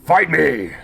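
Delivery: loud, high defiant shout; a hero's yell; battle yell. Acted. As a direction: hurl the challenge at the ogre. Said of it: "you yell".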